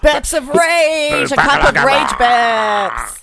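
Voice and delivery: loud, drawn-out mock roar; mock rage. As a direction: bellow it slowly like a war cry. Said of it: "do a big ragey one".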